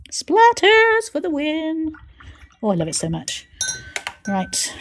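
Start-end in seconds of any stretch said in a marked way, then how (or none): 0.1-1.9 high, slow drawn-out victory call; slow high celebratory chant; triumphant cheer. Real, not acted. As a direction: crow it like a winning slogan. Celebrating the splatter effect.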